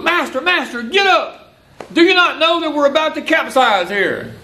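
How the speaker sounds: high, frantic plea to wake him; frantic disciples. Retold or imitated. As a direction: cry out like sailors in a sinking boat. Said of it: "the disciples were going bananas".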